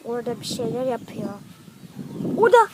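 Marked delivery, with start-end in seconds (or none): 2.4-2.7 loud alarmed shout, higher pitch. Real, not acted. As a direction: yell a warning in panic. Spots the chaser mid-game.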